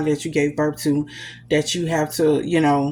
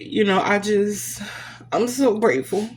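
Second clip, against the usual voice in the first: ordinary talk, nothing marked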